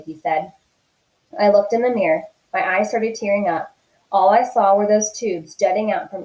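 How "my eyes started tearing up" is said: plain reading voice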